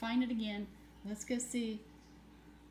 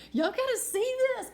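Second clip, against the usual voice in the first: excited; 13 semitones higher, 5 dB louder than usual. Real, not acted. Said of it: "I'm laughing".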